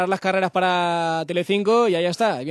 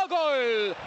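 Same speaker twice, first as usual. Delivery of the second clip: goal shout; 9 semitones higher, 5 dB quieter than usual. Real, not acted. Goal scored in commentary.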